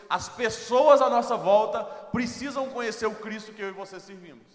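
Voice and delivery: loud, rising pastor's exhortation; preaching at full force. Acted. Pastor urging the church to share Jesus, loud preaching. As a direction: drive it home to the congregation.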